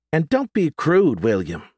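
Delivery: high prim scolding grandmother. Acted. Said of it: "Priscilla scolded".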